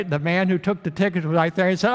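ordinary talk

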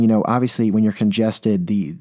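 ordinary delivery

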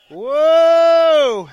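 loud joyful high whoa shout; ecstatic shout. Real, not acted. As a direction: shout it out in rapture. Charismatic worship outburst.

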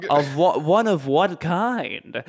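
lightly acted British man, slow probing question. British man questioning Kate about her proposal, slow mock curiosity.

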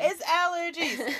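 drawn-out, high defensive squeal; high-pitched protest. Real, not acted. Host denying corona after a sneeze, high-pitched protest.

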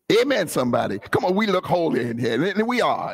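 ordinary talk, nothing marked

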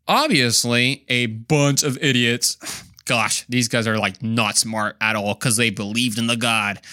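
lightly sarcastic put-down; a touch of sighing, ironic contempt; mock disdain. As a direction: pretend to scorn them. Sarcastic mockery of believing scientists.

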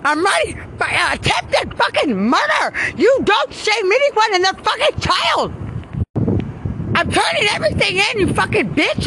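real full-force screamed rant, very high and loud; enraged high-pitched shouting; furious screaming. Real, not acted. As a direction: scream the accusations, stretching out the final words. Furious outburst at someone.

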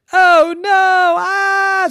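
very high, loud wail of alarm; terrified scream. A narrator's reading. Maven faced with a great big bear, high scream. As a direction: cry out high, stretching into a scream.

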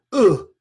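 big hammed-up groan of despair; dramatic sigh. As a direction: heave an exaggerated mock sigh. Acting out a pity party.

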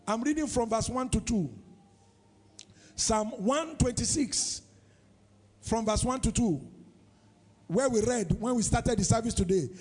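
ordinary talk

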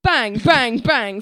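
loud, punched spoken bangs; three hard mock shots; mimed gunshots. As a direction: shout out pretend gunfire. Acts out shooting again.

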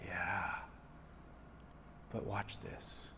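calm the son quietly; hushed father. Retold or imitated.

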